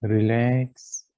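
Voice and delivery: ordinary talk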